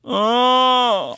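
long, high, loud held moan; patient's wailing moan. Retold or imitated. Imitating the man moaning all night in hospital, high wail. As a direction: wail one drawn-out note like a needy patient. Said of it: "that's what he would do".

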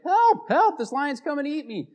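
very high, loud yell for help; desperate scream. Retold or imitated. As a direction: shriek it as the lion gets closer. Lion getting closer, he screams.

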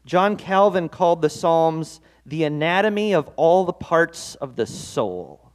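normal talking voice

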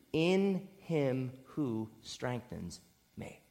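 soft, slow, every word weighed; "In him who strengthens me" drawn out quietly; slow hushed emphasis. Shown as a demonstration. Preacher to congregation, stressing the key phrase softly and slowly. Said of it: "The best part of verse thirteen is".

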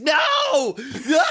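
put-on very high, loud kid's no; child's shout. Little boy refusing to let dad see his knee, shrieked no. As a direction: shriek it like a hurt toddler.

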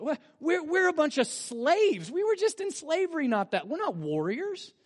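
high, whining, self-pitying protest; mock whine of frightened people; whiny Israelites. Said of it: "To which the people of Israel say".

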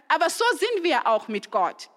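normal talking voice

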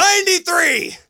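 high excited yell of a year; excited shout. Real, not acted. Cohost shouting the year at his partner, thrilled.

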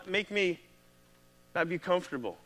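usual speaking voice

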